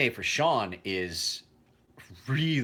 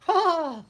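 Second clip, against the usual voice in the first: swooning sigh; 6 dB louder than usual. Retold or imitated. Women swooning over him.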